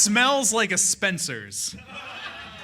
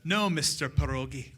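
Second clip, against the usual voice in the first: Bond villain; 4 semitones lower, 8 dB quieter than usual. Acted. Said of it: "he says".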